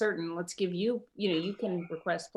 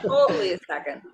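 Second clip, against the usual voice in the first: laughing; 8 dB louder than usual. Real, not acted. Joking agreement after yawn remark.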